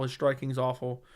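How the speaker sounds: normal talking voice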